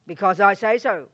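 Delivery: P=A; stern mummy. Retold or imitated. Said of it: "Mummy will say that".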